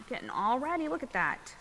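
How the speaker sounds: usual speaking voice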